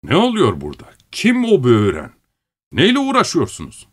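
sharp master's challenge, climbing in pitch; stern voice. A narrator's reading. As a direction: sternly question men caught idling. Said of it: "sert bir sesle".

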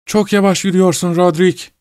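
high-pitched voice for the wife; high, calling taunt; taunting sleepwalker. A narrator's reading. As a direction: tease the pursuer in a high voice.

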